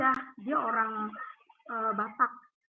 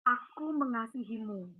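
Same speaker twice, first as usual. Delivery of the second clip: soft, slow, lower tender voice; very gentle; 3 semitones lower, 5 dB quieter than usual. Retold or imitated. Retelling the voice that spoke to her in the room, very gentle. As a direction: say it slowly and softly, with great tenderness. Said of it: "Dan itu lembut banget".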